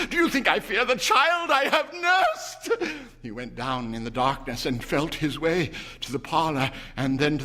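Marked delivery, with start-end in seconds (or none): 0.1-2.8 high, loud defiant question. A narrator's reading. Mother defying her husband's fear, high and loud. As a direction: fling it at him.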